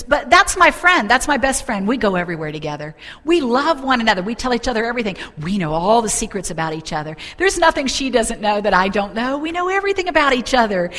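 high, loud acted pleading for a friend; indignant protest. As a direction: protest on your best friend's behalf, voice leaping up. Woman protests for her friend.

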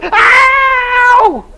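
high shrill imitated howl; woman's imitated cry; mock scream. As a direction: let out a sudden yelp.

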